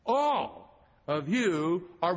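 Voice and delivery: slow, drawn-out "All" leading the phrase; stress on ALL. Shown as a demonstration. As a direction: lean hard on "All" and take it slowly. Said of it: "It starts with the word all".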